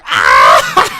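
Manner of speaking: a sudden loud jeering "Ah!" that breaks into laughter; mocking pointing yell with a laugh. Radio host acting out jeering at a candidate, mocking yell. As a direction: shout and point like a heckler mocking a fool. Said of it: "point at them and go".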